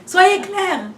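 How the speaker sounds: slow, loud and high, laughing through it. Real, not acted. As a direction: draw it out with a laugh, insisting. Laughing emphatic advice to women. (lent, fort et aigu, en riant)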